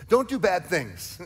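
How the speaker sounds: loud, slow mock scolding; hammed-up don't-do-it; mock stern warning. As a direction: wag a finger, half joking.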